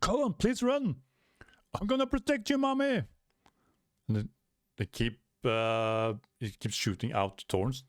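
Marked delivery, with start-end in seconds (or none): 0.0-3.0 put-on high pleading kid voice; a small kid bravely begging mom to run; high urgent child voice protecting mommy; brave little child. Child protecting his mother.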